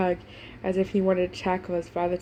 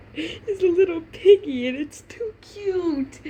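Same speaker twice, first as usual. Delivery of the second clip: high, slow squeal of cuteness; gushing high squeal; 10 semitones higher, 5 dB louder, slower than usual. Real, not acted. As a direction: melt over the cute piggy. Reader squeals over cuteness.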